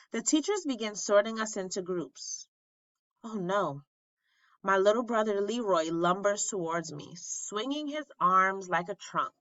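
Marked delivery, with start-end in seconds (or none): none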